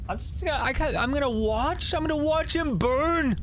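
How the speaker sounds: high, anguished wail; distraught. Real, not acted. Player helpless as the kid burns, anguished.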